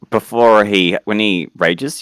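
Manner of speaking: ordinary talk, nothing marked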